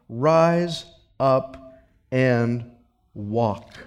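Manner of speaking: low, slow hushed command to rise; quiet command. Acted. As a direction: say it softly like Jesus speaking to the paralysed man. Jesus heals the paralytic.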